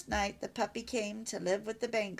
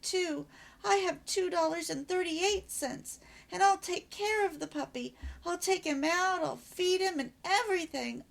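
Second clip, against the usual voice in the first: put-on higher girl's voice, earnest and eager; eager girl pleading; 9 semitones higher than usual. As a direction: promise mom everything to get the puppy. Patty pleading for the dog.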